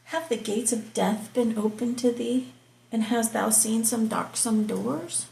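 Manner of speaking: measured, declaiming Bible reading; scripture reading. Acted. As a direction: read it out like from the pulpit. Reads Job 38 aloud.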